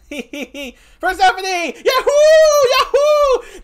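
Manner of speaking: very high, loud whoops; whooping welcome. Real, not acted. Streamer welcoming a second guest in chat, whooping. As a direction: cheer at the top of the voice. Cheers another guest's arrival.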